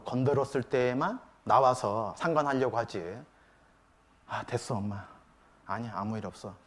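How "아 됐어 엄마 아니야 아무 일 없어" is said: low, very soft brush-off; dismissive teenager. Retold or imitated. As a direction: shut mother out quietly, as an imitation. Withdrawn teenager brushes mother off.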